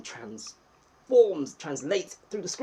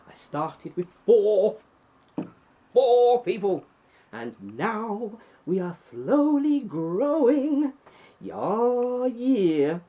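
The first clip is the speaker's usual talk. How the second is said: much higher, louder slow hammed-up boast; mock villain; 8 semitones higher, 8 dB louder, much slower than usual. Streamer boasting about eighteen viewers, mock villain gloat. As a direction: gloat like a cartoon supervillain.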